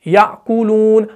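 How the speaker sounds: slow याकुलून, sounded with care; drawn-out reading. Shown as a demonstration. Teacher recites याकुलून slowly as the example. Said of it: "एक दूसरा लफ्ज है याकुलून".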